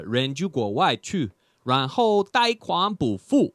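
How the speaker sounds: slow, solemn mock proverb in Chinese; mock fortune-cookie Chinese. Quoting a fake proverb to the crowd, solemn sage voice. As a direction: intone it like an ancient sage. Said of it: "My favorite one is".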